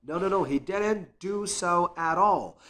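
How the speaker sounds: ordinary talk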